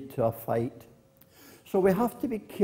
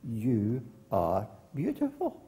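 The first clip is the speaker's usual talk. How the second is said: echo; 4 semitones lower, 4 dB quieter than usual. Acted. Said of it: "That was the echo of her voice".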